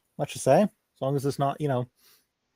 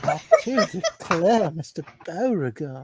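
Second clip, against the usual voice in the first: slow, high, laughing fake Southern Belle; hammed-up Southern Belle drawl; horrible Southern Belle; 5 semitones higher, slower than usual. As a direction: overplay a terrible Southern Belle, drawn out. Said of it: "my Southern Belle horrible on purpose".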